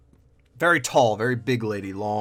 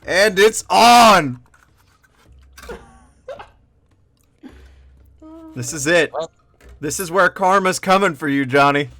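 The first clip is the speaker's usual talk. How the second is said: gleeful gloating; 7 semitones higher, 10 dB louder than usual. Real, not acted. Game master gloating at the player's failed roll, loud. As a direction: relish the player's bad roll.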